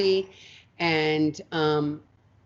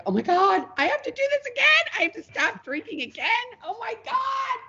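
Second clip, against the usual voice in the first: much higher, exasperated oh-my-God wail, rising to a cry; mock dismayed wail. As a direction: playfully wail in despair at having to quit drinking again. Retold dismay at third pregnancy.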